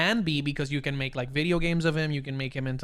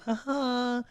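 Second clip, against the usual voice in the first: short laugh; 8 semitones higher than usual. Real, not acted. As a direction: let out a brief high chuckle. Laughs during Zoom aside.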